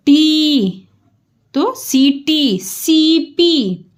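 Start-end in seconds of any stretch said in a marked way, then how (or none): 2.6-3.8 सीपी very slow with सी held; stretched सी. Shown as a demonstration. Teacher modelling a stretched सी in सीपी. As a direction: hold the सी long in सीपी. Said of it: "सी खींच के बोला".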